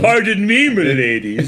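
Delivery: put-on high, old-fashioned courtly voice; bow and say it like a gallant; gallant gentleman. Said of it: "you start trying to mix it up".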